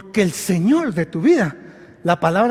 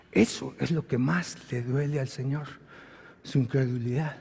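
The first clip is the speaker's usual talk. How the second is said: sorrowful; 4 semitones lower, 7 dB quieter than usual. Real, not acted. Husband pained by his wife's tears, softly sorrowful. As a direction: speak as if it hurts to say. Grief over wife's crying.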